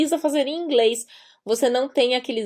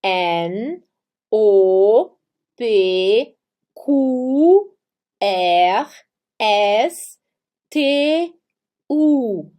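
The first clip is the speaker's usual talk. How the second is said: letters one by one, drawn out, paused; alphabet recitation; 2 semitones lower, 4 dB louder, much slower than usual. Shown as a demonstration. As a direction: recite the German alphabet for learners to repeat. (recitando o alfabeto alemão, letra por letra)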